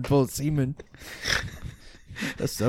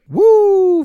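high, loud drawn-out woo; ghostly woo; 9 dB louder than usual. Pretend. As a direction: hoot like a spook. Cheering a ghost card name.

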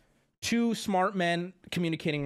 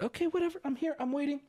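normal talking voice

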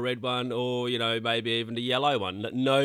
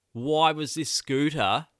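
normal talking voice

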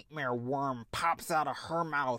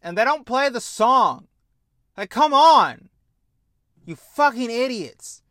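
loud exasperated outburst, higher; angry rant; 6 semitones higher, 10 dB louder than usual. Real, not acted. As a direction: yell at them in disbelief. Ranting at the show.

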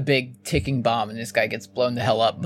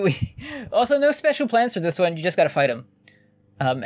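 usual speaking voice

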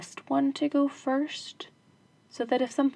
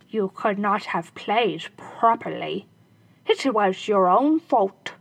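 louder, slow shoemaker retort; 5 dB louder, slower than usual. Acted. Shoemaker blaming the robbers, dismissive. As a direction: brush off the blame.

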